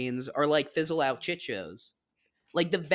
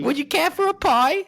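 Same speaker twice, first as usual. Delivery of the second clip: put-on hammy British accent; 11 semitones higher, 5 dB louder than usual. Taking listeners on a journey to London, in a hammed-up British accent. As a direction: play the posh Londoner to the hilt. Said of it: "take them on a journey to".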